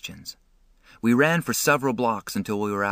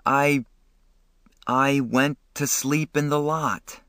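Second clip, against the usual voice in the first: stammering; 5 semitones higher, slower than usual. A narrator's reading. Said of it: "when I stammered".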